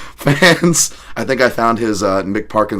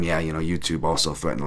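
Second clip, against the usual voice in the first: ordinary talk, nothing marked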